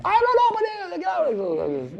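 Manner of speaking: put-on high, defiant child's voice; sulking child. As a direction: refuse like a stubborn boy on strike. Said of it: "so I'm on strike".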